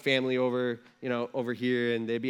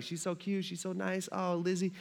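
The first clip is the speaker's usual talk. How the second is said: softer, tender she's so cute; mock doting; 3 semitones higher, 6 dB quieter than usual. Dad watching his daughter, softly doting. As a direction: coo fondly over your child.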